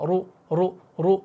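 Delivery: रु said thick, full-mouthed; heavy ru. Shown as a demonstration. Teacher drilling the syllable ru for students. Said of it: "मुंह को भारी करना है".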